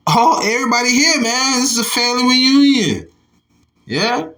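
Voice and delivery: high, excited burst at the map; thrilled, like spotting relatives; excited exclaim. Real, not acted. Reading the ancient map to viewers, excited high exclaim.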